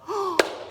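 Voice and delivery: sharp, high gasp; big storyteller's gasp of alarm; shocked gasp. Acted. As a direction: gasp in acted horror. Friends react in horror to news.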